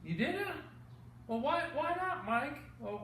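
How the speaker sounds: ordinary delivery, nothing marked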